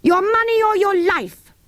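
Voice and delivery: high, far-off playacted robber; mock bandit demand. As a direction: hold up the baker's boy in play. Boys playing bandits.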